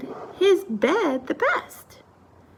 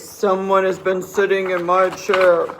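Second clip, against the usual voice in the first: great big, deep bear voice; booming father-bear voice; Papa Bear; 7 semitones lower than usual. A narrator's reading. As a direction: cry out low and heavy. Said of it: "cried out in his great big voice".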